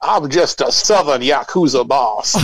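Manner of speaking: hammed-up Southern gangster boss; mock Southern accent. Co-host playing a Yakuza boss, mock Southern accent. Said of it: "that's the accent they get".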